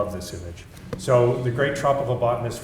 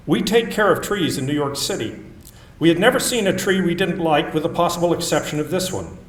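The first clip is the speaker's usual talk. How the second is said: louder, projected reading-aloud voice; book reading; 6 semitones higher, 8 dB louder, faster than usual. Acted. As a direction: read the opening of the book out to the room. Reads from her book aloud.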